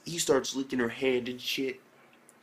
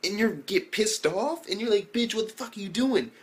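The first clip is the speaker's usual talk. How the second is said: outraged disbelief; 7 semitones higher than usual. Retold or imitated. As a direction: rant at him in total disbelief. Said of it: "I'm like".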